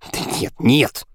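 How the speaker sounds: mildly alarmed, faltering no; flustered babbling. A narrator's reading. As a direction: babble a panicky refusal. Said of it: "лепетал Тюлип".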